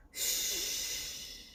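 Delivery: whispered hush; shushing whisper. Acted. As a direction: hush the crowd in the cinema. Shushing at the movies.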